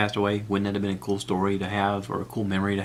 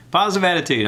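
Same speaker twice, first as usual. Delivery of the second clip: higher, louder cheer of the phrase; pumped-up self pep talk; 8 semitones higher, 5 dB louder, faster than usual. Shown as a demonstration. Psyching himself up about Bert, a loud cheerful chant. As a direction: hype yourself up.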